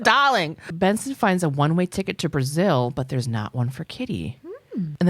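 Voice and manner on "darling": high, punched mocking 'darling'; mock-affected pet name, pitched up; imitated 'darling'. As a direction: mimic it with exaggerated affectation. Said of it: "I hated the way he said that".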